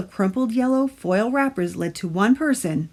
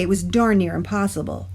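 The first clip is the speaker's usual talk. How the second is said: ordinary delivery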